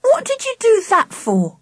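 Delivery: high-pitched, aggrieved question; reproachful. A narrator's reading. Charlie to dad after being startled, reproachful. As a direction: complain like a startled child. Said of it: "I looked up at my dad reproachfully".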